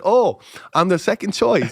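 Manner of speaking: high-pitched wounded pride; mock offended. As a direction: act slighted, pitch jumping up. Mock offence at being second choice.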